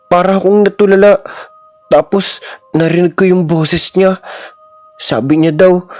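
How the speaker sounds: lightly voiced scared child; a hint of a frightened kid. A narrator's reading. Child tells Mama about the old woman, lightly voiced.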